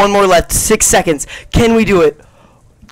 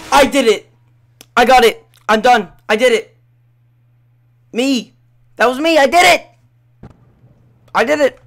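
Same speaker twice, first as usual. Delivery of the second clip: loud, high triumphant yelling; excited shouting; 5 semitones higher, 4 dB louder than usual. Real, not acted. Gamer beats Freddy, yelling in triumph. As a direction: shout the victory over and over.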